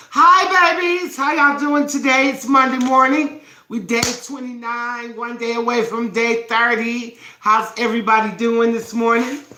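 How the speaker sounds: high, loud, bright hello to the viewers; bubbly live-stream greeting. Acted. Host greeting her live viewers, high and loud. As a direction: call out to the babies like a morning show host.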